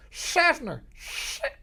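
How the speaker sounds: high, loud shout of "Seffner". Shown as a demonstration. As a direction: holler SEFFNER like a local. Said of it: "Just to say".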